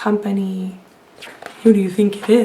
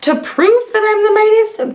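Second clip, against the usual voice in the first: much higher, booming mock bellow; giant bellow; 8 semitones higher than usual. A narrator's reading. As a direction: boom it out as a threat. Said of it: "bellowed the giant".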